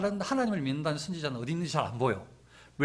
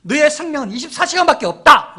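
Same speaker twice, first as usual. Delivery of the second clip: loud, high-pitched death threat; Jezebel's threat; 9 semitones higher, 13 dB louder than usual. Acted. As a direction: deliver a forceful royal ultimatum, building louder. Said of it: "이렇게 말한 거죠".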